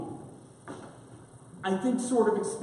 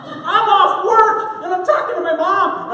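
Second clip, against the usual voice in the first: exaggerated cartoon intonation; 11 semitones higher, 9 dB louder than usual. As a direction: ham it up, laughing through it. Narrating in the Arnold voice.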